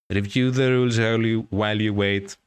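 loud commanding rule voice; commanding inscription. Acted. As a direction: order it like a ritual voice. Voice of the mirror's instruction.

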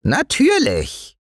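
high, drawn-out proud dwarf; tiny gnome showing off. A narrator's reading. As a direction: stretch it out self-importantly. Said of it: "antwortete das Waldmännchen stolz".

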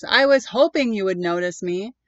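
loud monster voice; the creature finally greeting him. Acted. Anger creature speaks.

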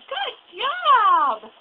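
loud delighted good job; excited praise. Real, not acted. Praising baby.

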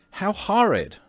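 high, loud cry of disgust; shocked cry. A narrator's reading. Mrs. Otis reacts to the bloodstain, with a high loud cry. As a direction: recoil with a high sharp cry. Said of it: "cried Mrs. Otis".

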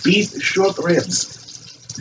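"beef short ribs" drawn out very slowly and softly in a put-on French accent; mock French accent. Host naming his famous dish, in a slow, soft mock French accent. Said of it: "I'm sick of the accent".

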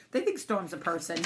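ordinary delivery, nothing marked

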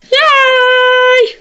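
very high, loud squeal; squealed yay. Acted. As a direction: shriek it like a cartoon. Squealed cheer.

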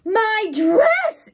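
high, loud shocked cry; a wife discovering her ruined dress; horrified exclamation. A narrator's reading. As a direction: wail it in dismay. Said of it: "exclaimed Missus Rogers".

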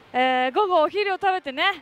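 ordinary talk

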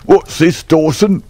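put-on a fierce old trainer catching his groom; demanding shout at his stable hand; angry cry. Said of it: "he cried".

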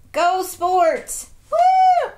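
loud high sports cheer with a whoop; playful cheer. Pretend. Showing off a bendable toy, playful whooping cheer. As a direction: cheer like a fan, ending very high.

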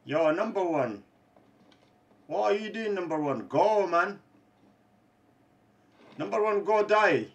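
loud jeering taunts at a losing player; mocking taunt. Real, not acted. Gamer taunting a teammate, loud jeering. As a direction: rub it in, loud and cocky.

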